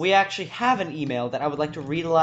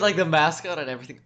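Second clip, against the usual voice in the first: ordinary delivery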